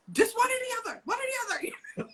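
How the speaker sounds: fed up, pitched high; exasperated. Retold or imitated. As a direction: set a limit with tired exasperation. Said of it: "I'm like".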